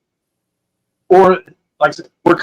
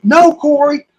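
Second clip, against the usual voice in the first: imitating the girls' high, insistent no; mock the panicked girls begging him not to go, pitched higher; adamant girls; 9 semitones higher, slower than usual. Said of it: "the girls are throwing a fit".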